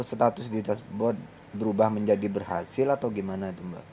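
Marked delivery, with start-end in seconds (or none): none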